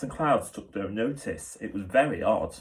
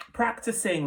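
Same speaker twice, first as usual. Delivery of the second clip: light, high and breezy; airy; 12 semitones higher than usual. A narrator's reading. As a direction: toss it off airily. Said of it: "the wolf said airily".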